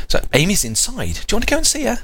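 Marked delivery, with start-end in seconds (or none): 1.3-2.0 put-on talking to a baby. Dad asking the baby.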